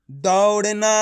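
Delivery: दौड़ना joined up, slow and even; blended syllables. Shown as a demonstration. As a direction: read दौड़ना as one stretched, careful word. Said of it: "तो मिलके बन जाएगा".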